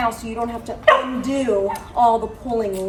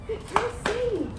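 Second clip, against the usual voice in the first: higher, sing-song dog praise; praising Sadie; 10 semitones higher, 3 dB quieter than usual. Real, not acted. Trainer praising Sadie at heel, high praise.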